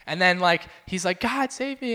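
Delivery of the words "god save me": high-pitched imitated plea for rescue; mock cry to heaven without shouting; calling out to God. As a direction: act out a drowning man pleading. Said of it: "he's calling out to God".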